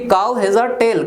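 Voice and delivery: slow and spaced, like dictation. Shown as a demonstration. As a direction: spell it out for the class. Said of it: "तो मैं ऐसा बोल सकता हूँ". (धीमे-धीमे बोलकर लिखवाना)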